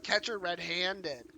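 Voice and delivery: usual speaking voice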